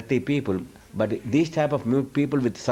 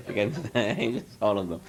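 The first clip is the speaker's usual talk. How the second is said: laughing. Real, not acted. Amused at the demon story.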